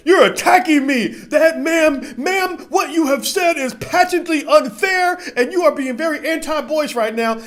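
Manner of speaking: high, loud, prissy, slow protest through a laugh; hammed-up offended voice; mock indignant self. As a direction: play the wounded gentleman whining to his fiancée. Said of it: "I'll be like".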